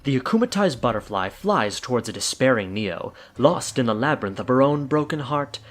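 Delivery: plain reading voice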